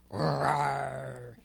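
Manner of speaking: low drawn-out mock roar; lion roar demo. Lioness showing the cub a low roar. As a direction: show him how to roar, low.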